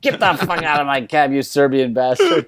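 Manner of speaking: put-on loud, high angry yell with an accent; furious cab driver. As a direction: throw him out of the cab. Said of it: "kicks him out of the cab".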